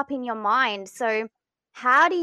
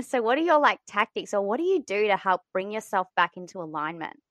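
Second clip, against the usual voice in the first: normal talking voice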